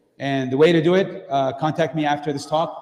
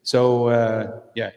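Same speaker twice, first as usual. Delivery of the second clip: normal talking voice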